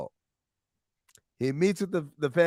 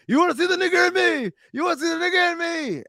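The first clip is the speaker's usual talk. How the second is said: rapid, much higher enraged shout; furious yell; much faster than usual. Retold or imitated. Saeed beating Robson in the library, screaming in fury. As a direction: spit the question out fast in a rage.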